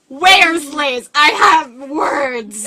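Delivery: loud, indignant phone demand; hammed-up affront, slow on the ending; mock offended. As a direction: play it outraged, drawing out the last word. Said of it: "get offended".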